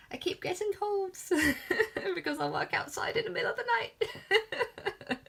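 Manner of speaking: high giggly voice through laughter; laughing at herself. Real, not acted. Laughing at herself while apologising.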